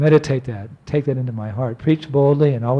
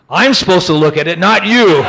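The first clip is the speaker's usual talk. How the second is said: loud imitation of an indignant officer; mock reprimand from a customs man; scolding official; 3 semitones higher, 7 dB louder than usual. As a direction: rap out the rebuke, rising to the last words.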